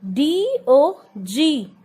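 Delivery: ordinary talk, nothing marked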